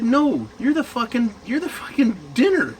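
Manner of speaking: high-pitched, insistent retold protest; exasperated retort. Retold exasperated retort to his friend.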